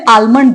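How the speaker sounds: आलमंड with a full L, aal-mund; common pronunciation. Shown as a demonstration. Teacher quoting a common man's request, L pronounced. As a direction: sound the L in आलमंड. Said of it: "बोला जाता है इस तरह से".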